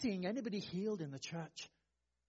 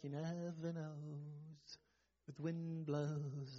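very slow, softer, airy mock-mystical voice; vague, fuzzy mockery; 6 semitones lower, 9 dB quieter, much slower than usual. Preacher mocking the idea of unreliable prayer: hazy, drawn-out, softer voice. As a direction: drift the words out like something vague and unreliable. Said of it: "some sort of vague, fuzzy".

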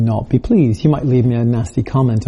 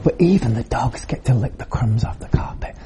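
whispered plea; 3 semitones lower, 5 dB quieter than usual. Acted. Preacher voices humble woman.

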